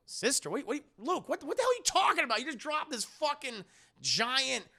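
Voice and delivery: high-pitched, stammering incredulity; acted flustered disbelief. Leia reacting to news of a sister, flustered. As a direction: sputter in comic disbelief.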